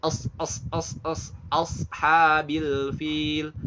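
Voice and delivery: AS repeated with a heavy S, then flowing into ASHABIL FIL; sad practice. Shown as a demonstration. Teacher practises sad with learners, heavy hissed S. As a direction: drill short AS, then recite ASHABIL FIL slowly. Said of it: "Sad, ya".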